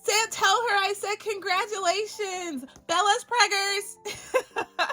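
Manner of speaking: high-pitched gleeful congratulations; excited congratulations. Real, not acted. Vlogger congratulating friend on Bella's baby, high and excited. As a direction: send congratulations in a high, thrilled voice.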